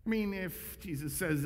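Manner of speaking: ordinary talk, nothing marked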